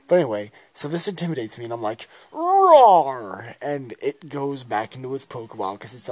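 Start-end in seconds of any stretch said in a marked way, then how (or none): 2.3-3.5 loud, high, drawn-out playful roar; big pretend creature roar; monster roar. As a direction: roar like a little battle monster. Acts out her Pokémon's roar.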